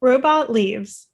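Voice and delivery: normal talking voice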